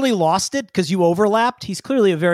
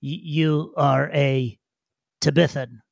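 slow, low, halting digger voice; stiff, careful little creature; Brillo; 4 semitones lower, slower than usual. Acted. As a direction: sound out the new word haltingly. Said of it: "Brilla looks at you and goes".

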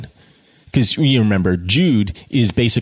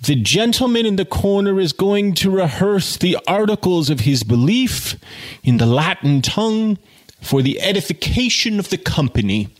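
higher, grand announcer voice; pompous announcement; 8 semitones higher than usual. A narrator's reading. Undergraduate mocking Jude to the bar, pompous and higher. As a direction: announce it with mock pomp. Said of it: "and announced".

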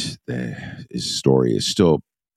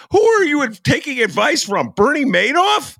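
incredulous; 6 dB louder than usual. Real, not acted. Mocking the justice's advisors.

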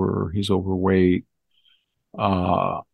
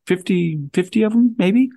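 mock Blinken, squeaking higher; 9 semitones higher than usual. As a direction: voice jumps much higher on fifty. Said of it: "I'm Anthony Blinken right now".

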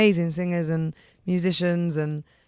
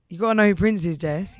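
normal talking voice

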